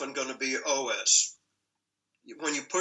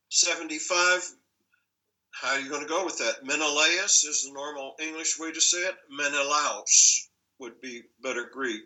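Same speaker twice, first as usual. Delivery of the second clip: ordinary talk, nothing marked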